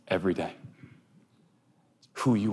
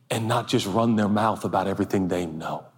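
normal talking voice

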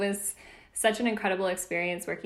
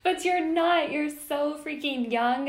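excited protest; 8 semitones higher, 5 dB louder than usual. Real, not acted. Protests guest's joke about age.

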